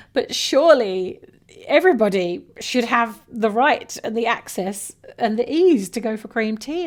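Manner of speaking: mock-outraged plea for cream tea for all; horrified protest. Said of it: "[name] was horrified".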